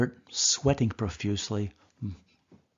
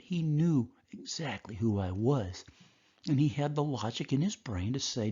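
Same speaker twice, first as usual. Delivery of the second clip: ordinary talk, nothing marked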